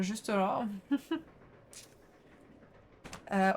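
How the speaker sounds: ordinary delivery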